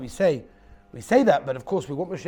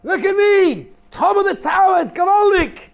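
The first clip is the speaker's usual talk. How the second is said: put-on high, loud, delighted child's yell from the tower top; child screaming; 14 dB louder than usual. A narrator's reading. Child on top of his tower, shouting in loud high glee. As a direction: belt it out like a thrilled kid on top of the world.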